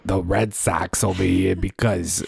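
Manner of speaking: put-on Boston bartender; mock Bostonian with dropped r's; Boston accent. Shown as a demonstration. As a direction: play a Boston local, accent thick. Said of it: "that voice".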